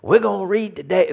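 high-pitched Cajun schoolteacher; Cajun teacher impression. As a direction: call the class in a strong Cajun accent. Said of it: "This is exactly the way she talks".

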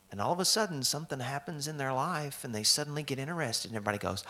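ordinary talk, nothing marked

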